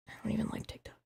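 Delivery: a secret admission, whispered; whispered aside. Real, not acted. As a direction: confide "I don't even like TikTok" in a whisper. Confessing aside about TikTok.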